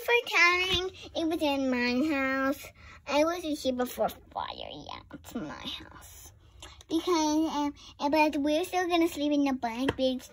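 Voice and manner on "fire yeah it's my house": low slow whisper; whispered aside. Pretend. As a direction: murmur it as a secret aside. Aside whisper during play.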